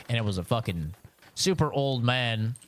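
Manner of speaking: ordinary delivery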